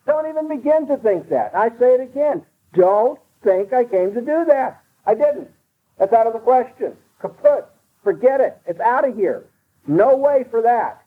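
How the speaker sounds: high pitched, insistent preaching; fervent pulpit climax; preaching at full force. Acted. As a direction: drive the point home, rising.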